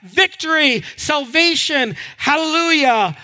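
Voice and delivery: high-pitched, jubilant crowd of freed people; rejoicing. Acted. Rescued Israelites thanking God after the Exodus, rejoicing. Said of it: "they rejoiced for a little bit".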